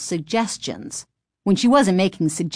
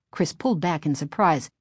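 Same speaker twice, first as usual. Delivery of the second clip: usual speaking voice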